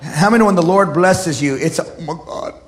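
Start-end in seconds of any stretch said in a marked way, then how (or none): 1.8-2.5 hushed, awestruck murmur; very soft, breathy disbelief; overwhelmed whisper. Shown as a demonstration. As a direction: barely get it out, stunned. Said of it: "You don't even say it like that".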